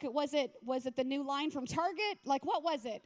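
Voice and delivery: normal talking voice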